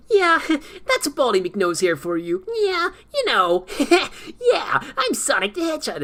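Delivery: very high, loud, squeaky cartoon hedgehog voice with laughs; mock the overly high-pitched Sonic, giggling; too-high Sonic voice. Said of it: "let me try to emulate what I'm talking about".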